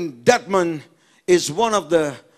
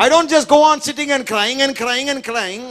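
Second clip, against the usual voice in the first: higher-pitched, insistent preacher voice; forceful preaching; 8 semitones higher than usual. Acted. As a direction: drive the repetition home like a preacher. (impassioned sermon delivery)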